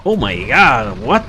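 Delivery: loud, high startled gasp of a word; shocked exclamation. Real, not acted. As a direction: blurt it out in shock. Shocked exclamation at game event.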